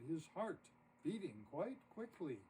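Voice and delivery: ordinary delivery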